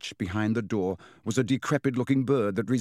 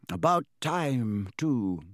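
Dumbledore; 2 semitones higher, slower than usual. A narrator's reading. Headmaster comments on his bird burning, slow and unruffled. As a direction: draw it out, quite untroubled.

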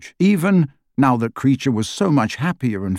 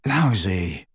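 low croak, weak and sore; 4 semitones lower, 4 dB quieter than usual. Acted. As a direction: croak it out like an injured man. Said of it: "croaked Ron".

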